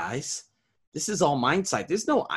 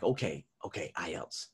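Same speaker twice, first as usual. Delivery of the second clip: softer, lower muttered okay; quiet to himself; 7 semitones lower, 10 dB quieter than usual. Retold or imitated. Teacher calmly confirms to himself, softer and lower. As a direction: keep it low under your breath. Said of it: "I'm like".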